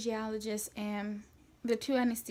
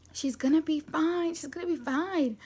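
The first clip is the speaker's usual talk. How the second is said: higher, emphatic repeated assurance; insistent reassurance; 6 semitones higher, 5 dB louder than usual. Retold or imitated. Mom insisting to the husband all is fine, emphatic. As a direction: imitate a mom insisting, voice pushed up.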